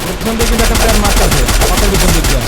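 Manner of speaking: loud urgent callout; cover me shout. Real, not acted. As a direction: shout the warning to your team. Warning of enemy shooting from behind.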